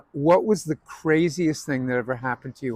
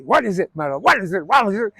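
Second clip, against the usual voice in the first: impatient demand building higher and louder; Zero Mostel pressing; 6 semitones higher, 5 dB louder, faster than usual. Retold or imitated. Tevye badgering Muddle on stage, building impatient demand. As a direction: press harder with each repeat. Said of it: "and he".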